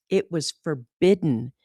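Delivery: ordinary delivery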